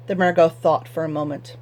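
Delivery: ordinary delivery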